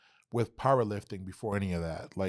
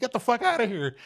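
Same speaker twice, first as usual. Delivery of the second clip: shocked disbelief; 4 dB louder than usual. Retold or imitated. Disbelief on meeting the filmmaker.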